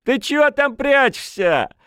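high, loud tipsy holler; rowdy, demanding shout. A narrator's reading. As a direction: yell for her to come out. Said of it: "крикнул парень".

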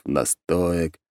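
ordinary delivery

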